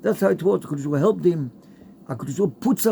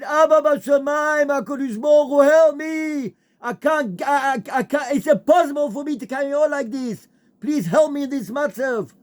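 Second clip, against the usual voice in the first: broken man screams his prayer in the dark woods; desperate crying scream; 10 semitones higher than usual. Retold or imitated. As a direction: cry out to God with nothing left. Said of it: "until he screamed his head off".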